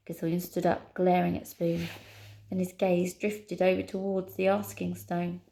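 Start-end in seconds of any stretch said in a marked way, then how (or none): none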